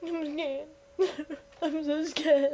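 mock fright with a laugh; mock scared. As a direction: pretend to be scared, giggling. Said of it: "I'm joking".

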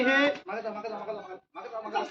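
very soft urgent whisper; hushed aside. Real, not acted. Magician quietly hiding children behind him.

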